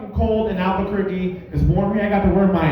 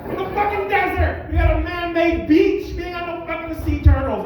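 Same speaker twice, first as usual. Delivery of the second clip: higher, shouted outrage; venting rant; 8 semitones higher than usual. Real, not acted. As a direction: blow up at the sea turtle excuse. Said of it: "I'm sorry, I'm venting".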